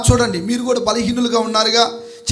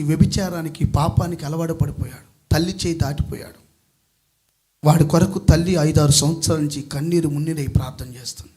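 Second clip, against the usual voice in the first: low, soft, solemn storytelling; subdued sermon testimony; hushed story narration; 8 semitones lower, 5 dB quieter than usual. A narrator's reading. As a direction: tell it gravely, with long pauses. Tells a sad testimony story.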